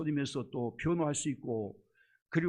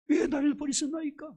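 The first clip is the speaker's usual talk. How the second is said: 왜 나를 버리시나이까 cried out much higher; anguished high cry; 12 semitones higher, 4 dB louder than usual. Retold or imitated. Jesus on the cross crying to God, 왜 나를 버리시나이까 high and anguished. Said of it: "그것도 얼마 컸던지".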